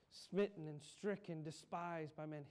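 ordinary talk, nothing marked

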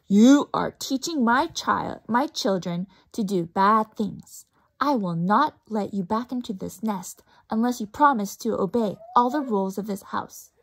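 loud stern scolding; stern mother bird. Acted. Mama Bird scolding Stella Luna, loud and stern. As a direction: lay down the law.

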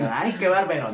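mock gushing praise. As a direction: gush like an over-the-top flatterer. Mock flattery, not meant. (admiración exagerada y fingida)

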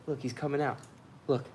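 ordinary talk, nothing marked

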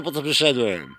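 ordinary talk

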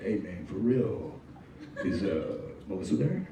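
lazy low drawl asking for her. Retold or imitated. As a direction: caller asking for Melissa, low and slow. Caller voiced asking for Melissa.

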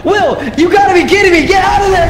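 high frantic yelling to run; screaming at a friend to flee; panicked screaming. Real, not acted. Friend attacked in game.

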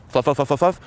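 high, rapid repeated babble; playful filler patter; mock fluff chatter. Shown as a demonstration. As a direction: rattle it off lightly and high. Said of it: "It's like".

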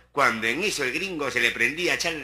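usual speaking voice

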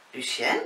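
"Lucien" with a rising, questioning end. A narrator's reading. Lavinia calls distracted Lucien back, "Lucien" in a questioning rise. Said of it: "Lavinia's vragende stem".

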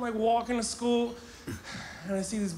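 ordinary talk

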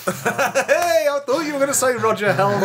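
high, fast, laughing. Real, not acted. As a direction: blurt it out while laughing. Laughing reaction to story.

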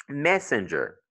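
"messenger" stressed on MESS, then falling; first-syllable stress. Shown as a demonstration. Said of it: "you get it on the first one".

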